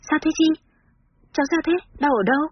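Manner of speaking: high, worried questions tumbling out; anxious rapid questions. A narrator's reading. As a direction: press her urgently, full of worry. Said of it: "lo lắng hỏi dồn".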